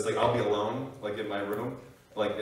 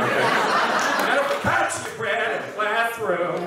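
loud, higher, drawn-out outcry; exaggerated proclamation; 7 semitones higher, 5 dB louder, much slower than usual. Comedian mocking an imagined classroom sign, exaggerated drawn-out shout. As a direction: stretch each word out and belt it.

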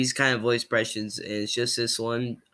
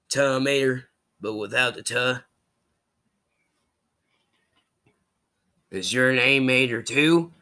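Mater. Acted. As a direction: play a dopey, friendly yokel. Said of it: "Mater from Cars".

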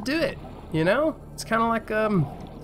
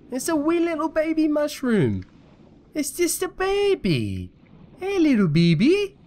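put-on high cooing baby talk; 9 semitones higher, 4 dB louder than usual. Gamer cooing at a wee mushroom, baby talk. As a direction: coo at it like a tiny infant.

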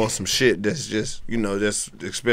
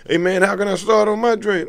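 mocking asker; 9 semitones higher than usual. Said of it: "quoting people asking him".

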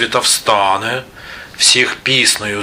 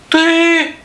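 very high squeaky mouse voice; little mice; 3 dB louder than usual. A narrator's reading. Mice answer the rooster's question, squeaky voice. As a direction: squeak one small drawn-out word. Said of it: "кажуть мишенята".

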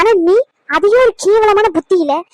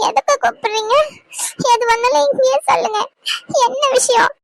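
high, weepy reluctant questioning; wounded, crying replies; tearful; 4 semitones higher than usual. Acted. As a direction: ask through tears, pitched up. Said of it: "இப்ப என்னத்துக்கு நீ அழுதுட்டு இருக்க".

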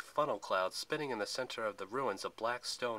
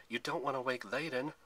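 usual speaking voice

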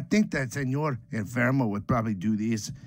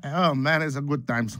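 ordinary talk

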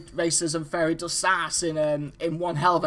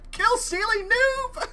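high, slow jeering cry; mocking jeer; slower than usual. Real, not acted. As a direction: stretch out the insult and mock him. Jeering at a kill stealer.

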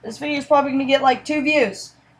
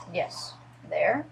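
quiet; 6 semitones lower, 10 dB quieter than usual. Real, not acted. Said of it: "I keep getting quiet".

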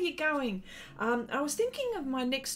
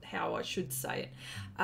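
muttered aside; 6 semitones lower, 7 dB quieter than usual. Real, not acted. Muttered self-correction.

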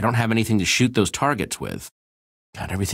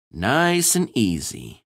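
usual speaking voice

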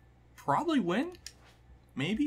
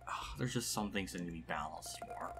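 whispered aside; 6 semitones lower, 11 dB quieter than usual. Real, not acted. Frustrated aside under breath.

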